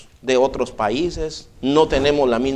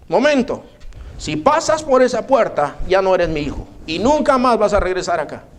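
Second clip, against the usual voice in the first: high threat; 3 semitones higher than usual. Retold or imitated. Mother warning her son at the door, raised voice. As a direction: warn him he can never come back.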